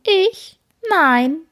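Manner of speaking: high mocking copy of the words; mocking echo. A narrator's reading. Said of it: "spottet es".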